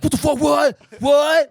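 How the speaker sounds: loud, high mock disbelief; Nick. Acting Nick's shocked reaction in story.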